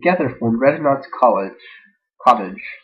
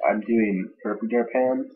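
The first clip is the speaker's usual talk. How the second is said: ordinary delivery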